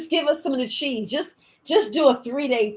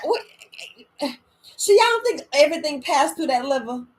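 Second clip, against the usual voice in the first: ordinary talk, nothing marked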